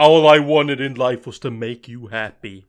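loud, hammed-up declaration; mock devotion. Mimicking someone declaring a life's devotion, mock devoted. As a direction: overplay the lovelorn pleading.

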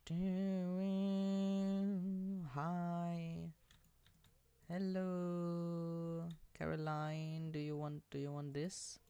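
sing-song high greeting voice; high sweet voice. Pretend. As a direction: coo it up high like to a pet. Greeting villager in put-on voice.

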